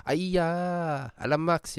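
Singaporean-accented sighing complaint; Singlish interjections. Shown as a demonstration. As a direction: exclaim in a Singlish accent, pitch up. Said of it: "they're interjections".

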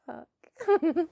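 ordinary talk, nothing marked

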